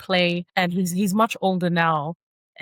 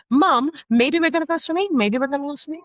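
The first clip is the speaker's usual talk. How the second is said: hammed-up child pestering again; insistent child; 9 semitones higher than usual. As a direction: pounce on Mom the moment she returns. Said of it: "come back".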